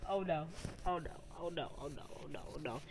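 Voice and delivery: low mumbled "oh no" chant, fading away; imitating helpless dread, trailing into near silence; retold dread. Retold panic as captors approach.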